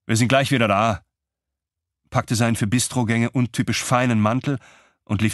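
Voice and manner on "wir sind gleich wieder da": ordinary delivery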